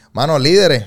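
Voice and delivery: a loud, high, punchy shout of the slogan; catchphrase shout. Acted. Guest shouts his motto to the host and audience, loud and high. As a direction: belt out líderes as a rallying cry.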